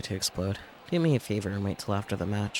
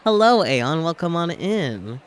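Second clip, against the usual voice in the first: excited greeting; 8 semitones higher, 7 dB louder, slower than usual. Real, not acted. Greets a stream chatter.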